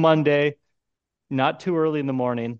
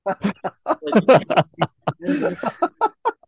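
high laugh; 8 semitones higher than usual. Real, not acted. Friends laughing at golf story.

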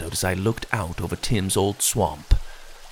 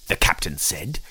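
ordinary delivery

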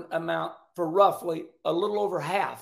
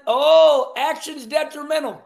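sudden high, loud oh; excited outburst; 7 semitones higher, 10 dB louder than usual. Real, not acted. Host reacts to the standings with a loud, excited oh. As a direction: blurt it out in delight. Points jump excites him.

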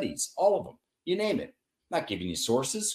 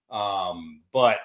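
ordinary talk

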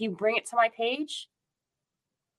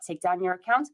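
normal talking voice

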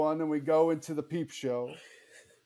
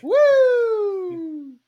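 high excited woo; 10 dB louder than usual. Retold or imitated. Cheering in the story.

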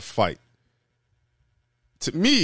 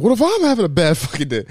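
higher-pitched mock-angry comeback; retold angry retort; 7 semitones higher than usual. Driver retorting to mom in shouting match, mock angry. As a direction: throw it back, pitched up and indignant. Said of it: "they get in a shouting match".

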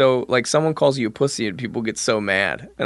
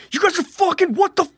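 high angry gamer yell; raging gamer; 4 dB louder, faster than usual. Retold or imitated. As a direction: yell like a furious college gamer. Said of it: "just like".

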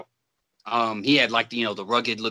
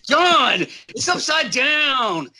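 high, loud, slow bully shout; hammed-up bully yelling at John; mock shout; 11 semitones higher, 3 dB louder, slower than usual. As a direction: yell it down at him like a drill sergeant. Retelling the bully's taunt.

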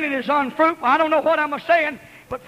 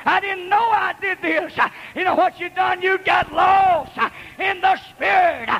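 preaching at full force; 5 semitones higher than usual. Real, not acted. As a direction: whip the congregation up, drawing out each "get lost". Preacher urging congregation.

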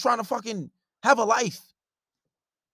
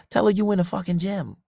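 usual speaking voice

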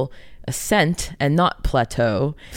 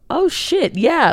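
realizing surprise; 13 semitones higher, 3 dB louder, slower than usual. Retold or imitated. Others realizing, quoted.

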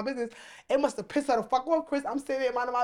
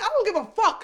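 defiant retort; 10 semitones higher, 6 dB louder than usual. Retold or imitated. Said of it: "I said".

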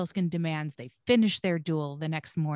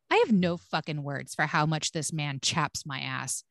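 ordinary talk, nothing marked

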